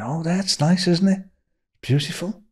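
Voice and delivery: high, softened polite approval; lightly played appreciative cooing; polite admiration. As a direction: act out making nice small talk. Politely admiring the restaurant, retold.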